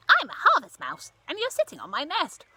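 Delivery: a tiny mouse introducing herself; little mouse voice. Acted. Mouse character voice.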